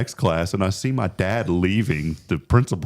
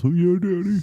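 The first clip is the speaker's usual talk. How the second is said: high, squeaky child imitation; mock little-boy voice; 12 semitones higher than usual. Boy answering his serious dad in the backyard, mock high child voice. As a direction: answer like an obedient little kid.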